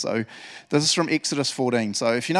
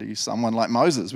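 normal talking voice

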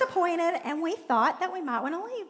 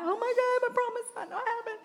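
higher, hammed-up worried cry; mock-fearful wail, fast at the end; mock panic; 9 semitones higher than usual. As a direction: play the anxious believer, rushing the second half. Acting out panicked believer.

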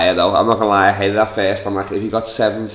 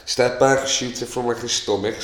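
ordinary delivery

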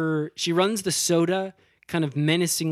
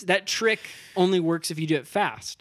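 usual speaking voice

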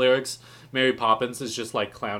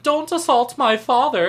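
son protesting; 12 semitones higher, 7 dB louder than usual. Retold or imitated. Son pleads with Mary Poppins' uncle.

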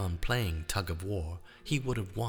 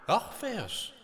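high, urgent call of a name; excited pleading; 13 semitones higher than usual. Acted. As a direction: beg him to lead, urgently. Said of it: "he kept saying".

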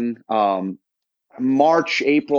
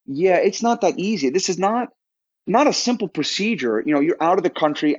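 higher, exasperated pushback, it's not that easy; exasperated retort; 6 semitones higher than usual. Retold or imitated. Telling people surgery abroad is not simple, exasperated and higher. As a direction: brush off the cheap-surgery advice with disbelief.

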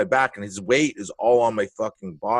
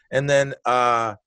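normal talking voice

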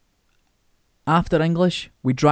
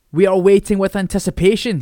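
sarcastic teacher; 5 semitones higher, 6 dB louder than usual. Acted. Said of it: "he said".

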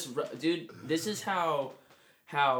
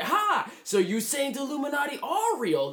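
high, loud mocking scoff; Seth Rogen; 11 semitones higher, 5 dB louder than usual. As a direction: jeer it as a hammed-up celebrity impression. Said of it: "Like in a Seth Rogen voice".

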